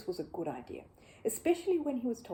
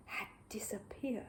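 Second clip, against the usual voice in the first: slow, soft near-whisper; hushed reveal; 11 dB quieter than usual. A narrator's reading. Narrator revealing the jar has vanished, whispered. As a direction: drop to a whisper for the shock.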